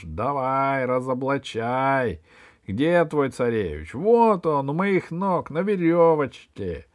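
accusing tirade turning to triumphant mockery; villain's gloating rant. Кусандра confronts the spy's helper, gloating angry rant. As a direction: rail at the enemy, then jeer.

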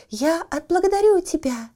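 high, thin squeaky little voice. A narrator's reading. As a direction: squeak it out like a tiny coal man. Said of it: "он пропищал".

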